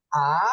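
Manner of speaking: A sliding upward like a startled question; rising tone. Shown as a demonstration. Teacher demonstrating second tone, rising A. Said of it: "Lihat nadanya".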